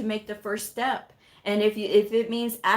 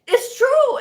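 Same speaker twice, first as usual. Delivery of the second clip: much higher and louder "It's true"; playful insistence; 9 dB louder than usual. Retold or imitated. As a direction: tease him with exaggerated praise. Teasing flattery, he laughs.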